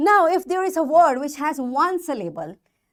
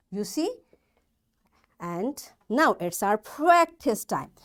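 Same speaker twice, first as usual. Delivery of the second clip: ordinary talk, nothing marked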